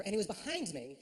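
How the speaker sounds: ordinary talk, nothing marked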